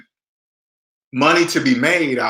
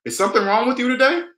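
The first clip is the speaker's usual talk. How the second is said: quick, higher, level-headed challenge; calm confronting question; 8 semitones higher, faster than usual. Shown as a demonstration. To a manager with an attitude, calm pointed question. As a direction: ask it straight but calmly. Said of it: "I would say it just like that".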